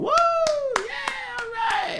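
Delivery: very high whooping cheer; crowd cheer. Retold or imitated. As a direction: whoop like a bar crowd after a home run.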